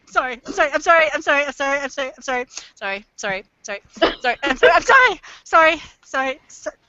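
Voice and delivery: rapid-fire sorries, dipping then swelling; mock compulsive apologizing on repeat; looping apologies. Canadian stuck in a loop of sorry, endlessly apologetic. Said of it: "How to keep a Canadian in a loop".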